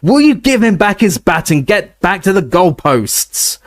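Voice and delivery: high, slow roar. A narrator's reading. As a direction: roar it across the pitch. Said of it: "roared Harry".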